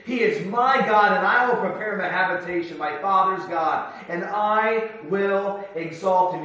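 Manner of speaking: ringing pulpit reading of the Bible; scripture reading. Acted. Reads scripture aloud in preaching.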